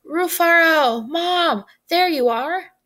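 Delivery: high, loud happy call; excited shout. A narrator's reading. Anansi leaps up calling to Mom and uncle, excited shout. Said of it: "Anansi leaped up and shouted".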